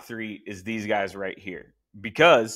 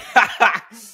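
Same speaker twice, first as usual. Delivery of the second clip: laugh; 11 semitones higher, 10 dB louder than usual. Real, not acted. Laughing after emotional plea.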